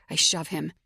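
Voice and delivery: usual speaking voice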